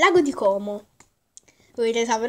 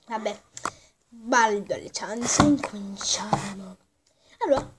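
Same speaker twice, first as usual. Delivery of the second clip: whispering; 3 semitones lower, 5 dB quieter than usual. Real, not acted. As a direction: breathe it out softly. Whispered start of video.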